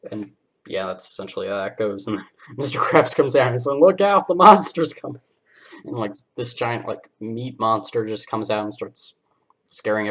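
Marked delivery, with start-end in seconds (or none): 3.8-5.2 higher, louder alarmed call; mock warning yell. Mister Krabs warning the diners, mock alarmed yell. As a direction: shout a hammed-up warning.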